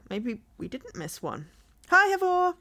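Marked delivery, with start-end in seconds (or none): 1.9-2.5 loud, high, bright hello; excited greeting. Real, not acted. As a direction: call out a cheery hi. Greeting a character.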